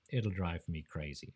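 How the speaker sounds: ordinary delivery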